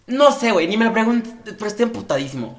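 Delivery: hammed-up angry complaint to a friend; mock furious rant. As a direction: play up the angry friend's frustration. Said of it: "y es como".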